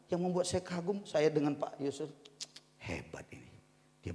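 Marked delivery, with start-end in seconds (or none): 2.8-4.2 whisper. Real, not acted. Admiring aside.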